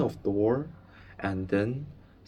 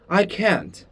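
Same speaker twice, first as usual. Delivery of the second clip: higher, near tears refusal; about to cry, afraid; close to tears; 9 semitones higher, 4 dB louder than usual. Acted. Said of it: "looking as if he was going to cry".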